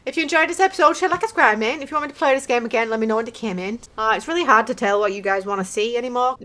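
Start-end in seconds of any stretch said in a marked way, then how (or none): none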